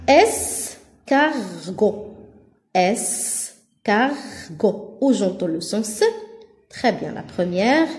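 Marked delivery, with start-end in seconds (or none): 0.1-4.2 slow "Escargot", split es, car, got; syllable by syllable. Shown as a demonstration. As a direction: pause after each syllable of "Escargot". (« Escargot » lentement, syllabe par syllabe)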